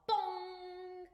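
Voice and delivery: loud, ringing mock gong; gong sound. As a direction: imitate a struck gong.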